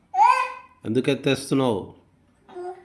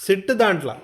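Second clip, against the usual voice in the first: raised, higher-pitched order; insistent command; 8 semitones higher, 4 dB louder than usual. Real, not acted. Parent insisting a child sit inside, insistent command. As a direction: repeat it firmly, voice jumping up.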